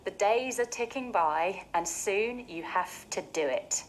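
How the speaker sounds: ordinary delivery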